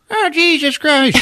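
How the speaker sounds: very high shocked squeal; mock Xenia exclamation. Retelling Xenia hit by debris, high shocked squeal. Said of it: "Xenia's in there fucking like".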